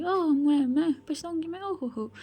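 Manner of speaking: high, exaggerated mock amazement; hammed-up taunting echo; mocking disbelief. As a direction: scoff at the threat, ending in a mock laugh. Mocks the threat with fake laugh.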